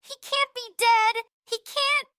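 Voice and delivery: high frantic cry of denial; desperate, breaking with grief; grief-crazed. Acted. Friend refuses to accept death.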